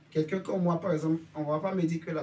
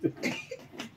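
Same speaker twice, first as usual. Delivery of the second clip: laughing; 4 dB quieter than usual. Real, not acted. Laughs at "il a triché.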